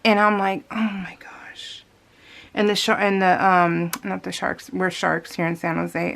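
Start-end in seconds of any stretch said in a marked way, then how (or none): 0.7-1.8 slow, breathless whisper of dismay; a slow whispered gasp of disbelief. Retold or imitated. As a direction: whisper it slowly as the bus pulls away. Watching the bus leave.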